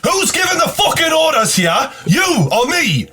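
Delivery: very high, strained indignant tirade; retold furious rant. Retelling threat to cab driver over orders, heated. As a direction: build the threat at high pitch, slowing on the insult.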